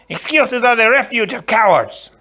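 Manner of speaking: high, loud scorn; cutting interruption. Acted. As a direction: cut in hard. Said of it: "the Sicilian interrupted".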